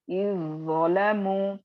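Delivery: "इज़्ज़लमू" slow, with ज़ doubled and जाल dropped; recitation demo. Shown as a demonstration. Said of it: "जाल नहीं आएगा". (धीमी तिलावत, ज़ को दोहराकर)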